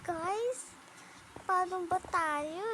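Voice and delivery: ordinary talk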